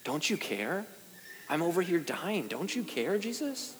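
a choked cry to Jesus, held back; desperate plea. Retold or imitated. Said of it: "I just want to scream at him".

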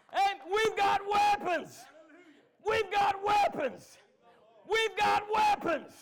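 high, loud shouted preaching with drawn-out key words; full-force preaching. Acted. Preacher rallying church over their weapons, shouting. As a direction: roar it out to rouse the congregation.

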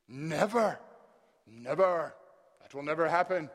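loud, falling "never"; emphatic refusal. Acted. Acting Peter's emphatic refusal.

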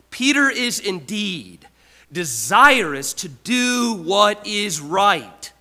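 loud, slow, emphatic reading of the quote; preaching at full force. Acted. Preacher reads Calvin aloud to the congregation, loud and slow.

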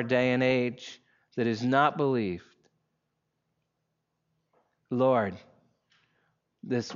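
low, measured prayer voice. Real, not acted. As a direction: pray with unhurried pauses. Leads an opening prayer.

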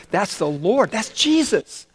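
dawning recognition breaking into excitement; excited realization. Acted. John tells Peter who is on the shore, excited realization.

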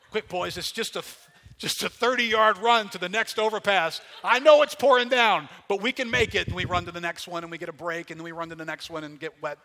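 put-on loud, high shout to hurry the boys; rally them over the pouring rain, easing off as you go; urgent shouting. Urging his boys through rain.

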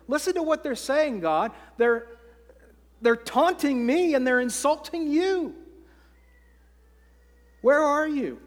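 David crying out about his enemies; pleading cry. Acted. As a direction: protest the taunts to God, rising. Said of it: "He says".

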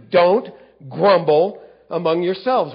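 "Don't" hit hard and loud. Shown as a demonstration. Preacher rereading Jesus' command to the crowd, emphatic. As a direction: bark the command out with force. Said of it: "rereading the rebuke emphatically".

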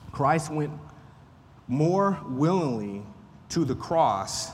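slow, weighted reading with pauses between phrases; deliberate quotation reading. Acted. Teacher reading a Puritan preacher's line to the group, slow and deliberate. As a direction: read it out slowly, phrase by phrase, dropping at the end.

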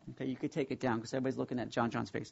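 low, soft, rapid aside; quick aside. Real, not acted. Preacher to slide operator about taking it down, quiet aside. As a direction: drop the preaching voice and speak quickly, softly.

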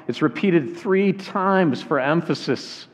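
ordinary talk, nothing marked

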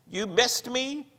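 high, thin, slow croaking voice; small bleating croak. Acted. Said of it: "he croaked in a small, bleating voice".

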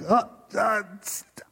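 high, halting false starts; cut-off interjecting. Acted. The Lord trying to get a word in, mock stammering. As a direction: try and fail to break into someone's monologue. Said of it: "he can't get a word in".

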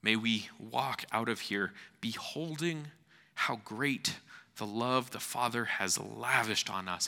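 usual speaking voice

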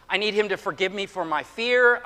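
ordinary talk

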